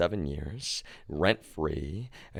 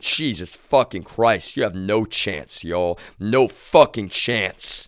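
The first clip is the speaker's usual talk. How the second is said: exasperated outburst; 3 semitones higher, 6 dB louder, slower than usual. Real, not acted. Exasperated rant.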